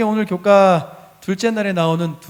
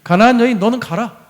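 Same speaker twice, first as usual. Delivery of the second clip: loud, raised command; stern acted rebuke, louder at the start; harsh dismissal; 4 semitones higher, 9 dB louder than usual. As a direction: order her off forcefully. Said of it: "이렇게 세게 말씀을 하셔요".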